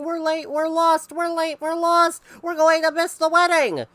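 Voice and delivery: high loud yell of a hurrying family; frantic family yelling. A narrator's reading. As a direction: wail it together, running late. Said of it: "came running down the road yelling".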